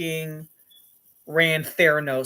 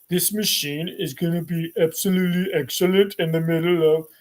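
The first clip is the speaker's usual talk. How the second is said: lightly put-on executive voice; a hint of forced founder register; Elizabeth Holmes. Founder promises machine is excellent, lightly put-on voice. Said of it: "when I decided to talk like this".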